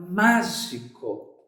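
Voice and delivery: mágico dito devagar, separando as sílabas; mágico, bem pausado e grave; slow word. Shown as a demonstration. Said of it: "Outra palavra". (mágico pronunciado lentamente, sílaba por sílaba)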